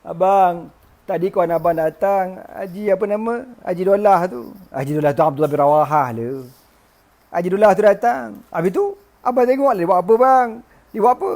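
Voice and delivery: high voice climbing into a wail; hammed-up sobbing tattle; mock tearful report. Wife tells husband who broke his idol, mock tearful. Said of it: "Menangis bini dia".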